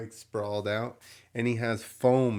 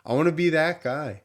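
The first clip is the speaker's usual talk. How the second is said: eager wish; 6 semitones higher, 6 dB louder than usual. Retold or imitated. Wishing to be the masked dancer.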